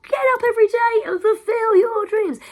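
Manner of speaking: high, loud cheerleader voice; hype encourager. Retold or imitated. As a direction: pump them up like a hype man. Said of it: "No one's going, "Yo".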